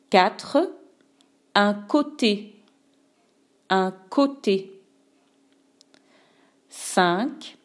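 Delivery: slow, clear dictation with pauses; dictating. Acted. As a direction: read each word for writing down. Teacher dictating words aloud.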